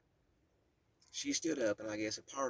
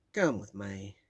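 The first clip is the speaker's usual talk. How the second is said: put-on Southern Kentucky accent; 3 semitones lower, 7 dB louder, slower than usual. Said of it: "A deep Southern Kentucky accent".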